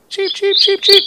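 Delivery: very high, short repeated cheeps; imitated bird chirps. Storyteller imitating the bird heard behind the car, high chirping.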